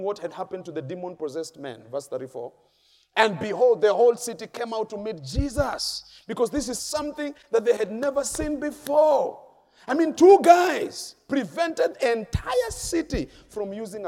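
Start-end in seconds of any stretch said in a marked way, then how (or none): 3.1-6.0 loud, high proclamation; preacher's voice raised high and loud; full-force preaching. Real, not acted. Preacher dramatizes the scripture climax. 6.3-14.1 high, loud, emphatic preaching; raised, exclaiming preacher's delivery; full-force preaching. Real, not acted.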